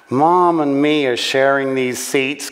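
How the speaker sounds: put-on American kid voice; giggly American daughter. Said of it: "giggled Linda".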